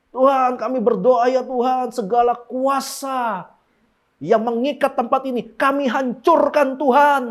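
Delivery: high, slow, loud declaring voice; hammed-up warfare prayer. Preacher mocking a warfare prayer, loud and slow. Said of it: "doa peperangan".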